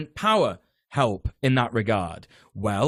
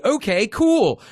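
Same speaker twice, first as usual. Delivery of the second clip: higher, louder mock-casual okay; breezy feigned agreement; 9 semitones higher, 6 dB louder, slower than usual. Tenant brushing off landlord's text about rent, breezy feigned agreement. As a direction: play along with exaggerated ease.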